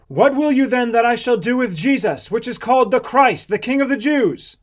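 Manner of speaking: loud, high question to a crowd; public appeal. A narrator's reading. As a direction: call the question out over the mob. Pilate asks the shouting crowd.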